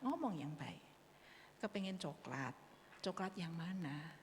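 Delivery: gentle and hushed, ending in a whisper; calm soothing voice. Acted. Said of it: "Kita menenangkan dengan suara yang tenang".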